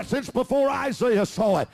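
ordinary delivery

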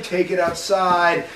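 dragged-out slow command; slow flat order. Retold or imitated. Manager telling the couple to take it outside, slow. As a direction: say it very slowly. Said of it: "the manager's like".

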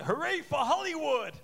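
mock American accent, hammed-up cheer. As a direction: play up the American twang. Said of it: "I can still do it".

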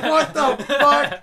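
very high, loud, drawn-out disbelief; hammed-up horrified yell through laughter; horrified shriek. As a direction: shriek it slowly, appalled and cracking up. Retold horror at ripped-out eye.

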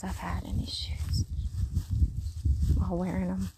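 confiding whisper. Real, not acted. Telling viewers about his worn shoes, whispered. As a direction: let it drop to a secret whisper.

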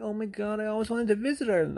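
high-pitched mock gushing; excited admirer. Flirting stranger hears he's from Ireland, excited.

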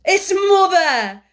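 joyful high loud cry; excited cry. A narrator's reading. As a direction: cry out with joy. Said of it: "Dickon again said".

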